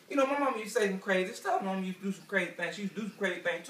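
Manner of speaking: ordinary talk, nothing marked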